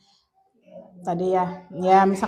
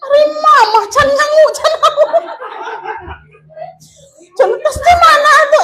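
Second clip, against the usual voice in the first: much higher, louder mock complaint with laughter; laughing, hammed-up husband grumble; mock grumpy husband; 8 dB louder than usual. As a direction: ham up the sulky husband, laughing. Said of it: "suami teh jemedun".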